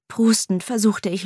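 ordinary delivery

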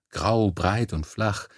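ordinary delivery

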